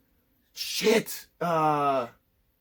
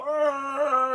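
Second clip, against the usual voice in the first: a high hammed-up roar; cartoonish high argh; mock pained roar; 11 semitones higher than usual. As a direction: yell it like a melting villain. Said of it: "he was all".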